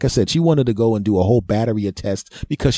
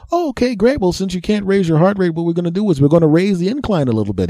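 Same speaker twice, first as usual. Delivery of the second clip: much higher, quick chirpy doctor; 9 semitones higher than usual. Retold or imitated. Doctor explaining incline to patient, high chirpy voice. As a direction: play the doctor high and brisk.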